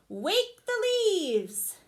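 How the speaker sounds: slow, high, loud command; strong call. Acted. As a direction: call it out boldly. Wadney's voice now stronger.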